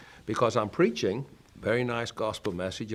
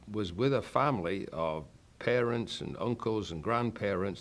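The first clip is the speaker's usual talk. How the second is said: normal talking voice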